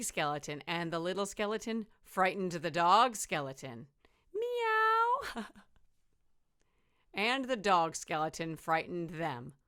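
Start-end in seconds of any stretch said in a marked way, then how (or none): 4.3-5.4 high loud meow. Acted. Dog frightened, cat noise.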